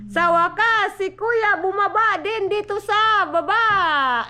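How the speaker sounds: cheering that big brother finally came down; excited announcement. Real, not acted. Mom announcing Kuya finally came downstairs, excited and loud.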